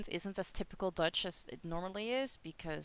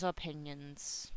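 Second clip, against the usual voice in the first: opinions drawn out slow, lower and much softer; dismissive repeat; 5 semitones lower, 11 dB quieter, slower than usual. Shown as a demonstration. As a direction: let opinions trail off with a shrug. Repeats word, second take softer.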